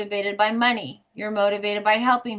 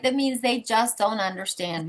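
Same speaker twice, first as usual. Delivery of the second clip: normal talking voice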